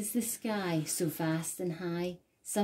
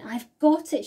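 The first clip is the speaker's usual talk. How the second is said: higher and louder, sudden idea; excited cry; 7 semitones higher, 8 dB louder than usual. A narrator's reading. Snail crying out her rescue plan, excited. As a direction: snap out the idea. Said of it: "she cried".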